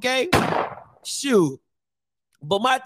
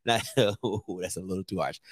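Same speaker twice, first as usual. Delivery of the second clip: laughing quietly at himself; 11 dB quieter, faster than usual. Real, not acted.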